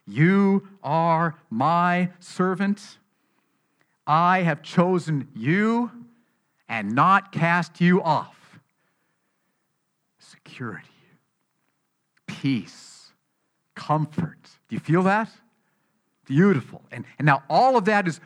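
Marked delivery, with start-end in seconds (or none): 0.1-8.6 slow, weighty, raised-voice proclamation; emphatic divine declaration. Acted. Repeats God's words to the congregation. 10.2-14.6 low, near-whispered single words; hushed reassurance. Real, not acted. Lets the comforting words sink in.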